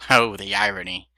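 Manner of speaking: ordinary delivery, nothing marked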